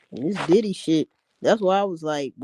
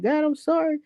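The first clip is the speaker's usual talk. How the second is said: high, quick put-on pleading voice; mock guilty monk; 7 semitones higher, faster than usual. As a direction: beg God like a guilty monk.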